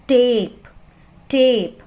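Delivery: टेप with a long ए sound; टेप said slowly with a long vowel; long vowel demo. Shown as a demonstration. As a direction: stretch the ए in टेप. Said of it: "ए को हम long vowel sound use करेंगे".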